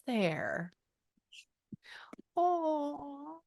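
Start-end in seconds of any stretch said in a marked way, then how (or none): 2.4-3.4 touched aw. Real, not acted. Touched by dad's wallpaper photo.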